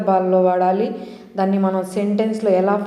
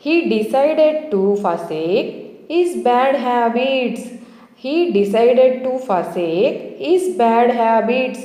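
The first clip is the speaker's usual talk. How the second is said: slow example reading; 3 semitones higher, much slower than usual. Shown as a demonstration. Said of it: "reading an example sentence slowly".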